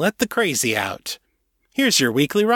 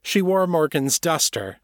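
ordinary delivery

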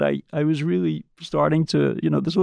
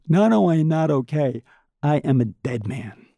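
usual speaking voice

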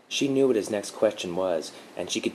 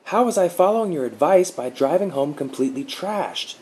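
high, loud accusing outburst; much higher, louder accusation at his guardian; blurted; 11 semitones higher, 7 dB louder than usual. A narrator's reading. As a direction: blurt it out bitterly. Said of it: "Mark blurted".

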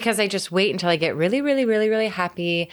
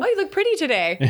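gushing admirer; 12 semitones higher, faster than usual. Retold or imitated. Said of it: "Oh, hello".

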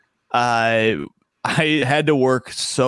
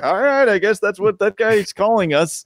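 higher put-on voice of a shrugging audience member; a lightly voiced crowd accepting the nickname; mock resigned crowd; 7 semitones higher than usual. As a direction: play a puzzled crowd that just goes along with it. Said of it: "everybody's like".

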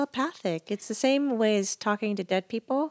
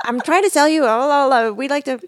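high, loud mock sales patter; telemarketer; 5 semitones higher, 10 dB louder than usual. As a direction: plough on with the pitch through the laughter. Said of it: "the man on the other end".

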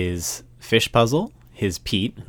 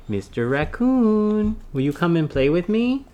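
sing-song prompt; 7 semitones higher than usual. Shown as a demonstration.

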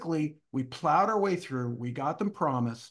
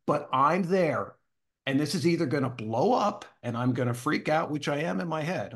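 ordinary talk, nothing marked

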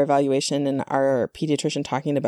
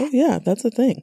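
higher-pitched reassuring retold reply; matter-of-fact confirmation; 8 semitones higher than usual. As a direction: confirm it readily, voicing someone else's answer. Retells pediatrician confirming it.

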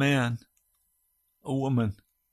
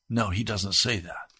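ordinary delivery

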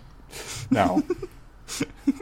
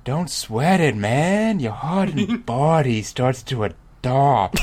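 higher-pitched easygoing dude; drawn-out character voice, higher than usual; corrected dude voice; 4 semitones higher, slower than usual. Acted. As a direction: reassure like a laid-back dude, pitched up. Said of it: "That's wrong voice for this dude".

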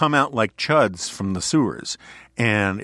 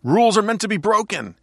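mock yell, pitched high and louder; Deadpool; 11 semitones higher, 5 dB louder than usual. Host quoting a movie line to co-host, mock yelling. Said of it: "Deadpool is yelling".

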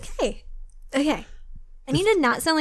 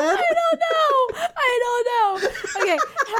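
giggling while speaking; laughing; 13 semitones higher than usual. Real, not acted. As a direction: laugh through the words. Flustered, laughing.

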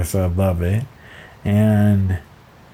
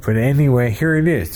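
ordinary delivery

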